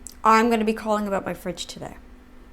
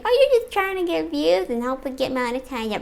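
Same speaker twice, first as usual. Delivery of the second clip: mocking critic voice; 5 semitones higher, faster than usual. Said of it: "for those who might wanna criticize me".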